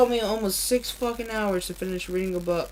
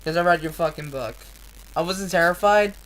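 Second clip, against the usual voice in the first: ordinary talk, nothing marked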